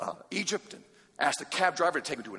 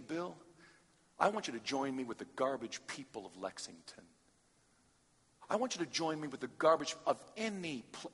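softer, tender invitation; Jesus speaking gently; 3 semitones lower, 9 dB quieter than usual. Pretend. Jesus inviting Bill to join him, tender and soft. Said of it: "could you say to me".

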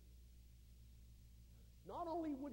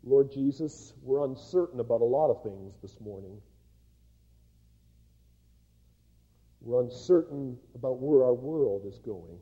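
loud, lower prayer voice; preacher leading the church in prayer, loud; fervent public prayer; 8 semitones lower, 19 dB louder than usual. Acted. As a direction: pray aloud over the congregation. Leads a congregational prayer.

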